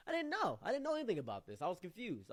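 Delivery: high, loud, insistent outburst; animated storyteller's exclamation, easing down; emphatic protest. Real, not acted. Protesting own ignorance in the story.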